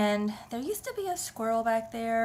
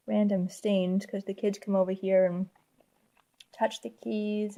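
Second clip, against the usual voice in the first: normal talking voice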